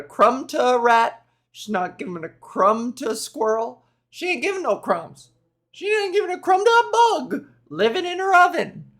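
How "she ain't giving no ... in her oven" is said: sassy attitude. Pretend. Sassy escalating crumb riff.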